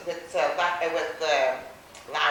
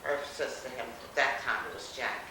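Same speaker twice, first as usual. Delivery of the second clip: usual speaking voice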